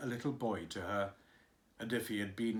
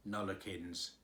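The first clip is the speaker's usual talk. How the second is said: stolid gardener; 6 semitones lower, 7 dB quieter than usual. A narrator's reading. Gardener answering the nurse, low and stolid.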